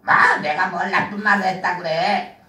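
low, sly villain puppet voice; a low tempter coaxing the child. Acted.

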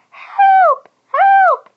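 very high, loud squeaky worm cries for help; Cheryl crying out. A narrator's reading. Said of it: "she cried".

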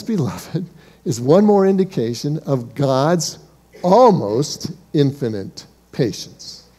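ordinary talk

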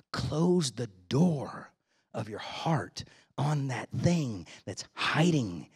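slow, whispered warning; slow down and drop to a whisper; urgent whispered plea; hushed warning. Retold or imitated.

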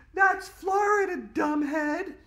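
put-on very high, loud bossy little girl; girl corrects a classmate, high and bossy; snooty know-it-all girl. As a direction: squeak it out, correcting him with scorn. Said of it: "said Andrea".

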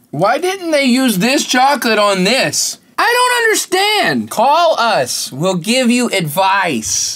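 silly high voice. Pretend. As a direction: whine it like a cartoon character. Silly voice complaining about the product.

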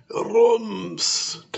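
booming thump sound; loud high rums effect. A narrator's reading. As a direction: boom it out like a bang.

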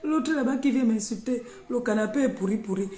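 rubbishing the rival, high and loud; high mocking jeer. Real, not acted. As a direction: sneer it out up high. Mocking someone who insults her.

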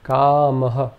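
kamaha ending in a short aspirated ha; visarga echo. Shown as a demonstration. Teacher demonstrating visarga, kamaha with aspirated ending. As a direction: breathe out the final ha in kamaha. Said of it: "Some examples to illustrate this are".

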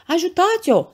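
high, loud cry of alarm; frightened scream for help. A narrator's reading. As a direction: scream out as the panicked mayor's wife. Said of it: "țipa soția primarului". (țipăt ascuțit după ajutor)

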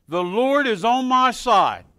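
loud, slow proclamation; preaching a verse. Acted. Preacher proclaims Psalm 118 to the church, loud and slow. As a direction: proclaim it like a banner.

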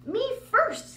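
high loud boastful call; proud. Acted. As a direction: puff up and claim first place. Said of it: "all puffed with pride".

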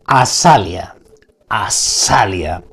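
slow, low spoken spell word; slow incantation. Shown as a demonstration. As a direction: intone it slowly and low. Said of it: "vamos con la segunda palabra".

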